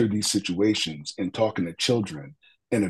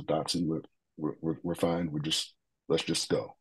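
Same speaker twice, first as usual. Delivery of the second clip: shaken quiet reply; 3 semitones lower, 7 dB quieter than usual. Retold or imitated. Tearful partner brushing it off.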